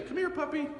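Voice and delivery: high, sing-song call to a puppy. Retold or imitated. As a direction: coax the puppy over in a high voice. Owner calling the puppy again.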